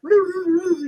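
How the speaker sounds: high, playful woo-woo; spooked doctors hooting as they leave; mock woo. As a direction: hoot it like spooked doctors scurrying out. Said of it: "They're all like".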